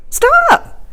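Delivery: very high, loud stop; sudden cry. Acted. Old lady stopping the young man from eating, a sharp high cry. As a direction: halt him at once.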